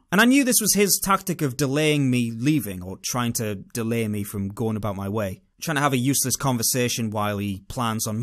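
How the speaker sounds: normal talking voice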